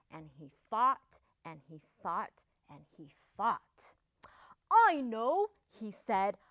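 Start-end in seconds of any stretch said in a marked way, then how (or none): 4.7-5.5 hopeful realization. A narrator's reading. Wolf has a hopeful idea.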